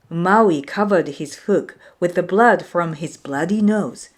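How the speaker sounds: usual speaking voice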